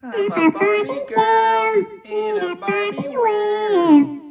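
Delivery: put-on very high, slow sung baby voice; chipmunk-like sung line; baby voice effect. Shown as a demonstration. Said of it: "Baby voice mode".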